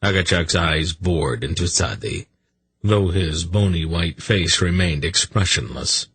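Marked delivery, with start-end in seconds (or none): none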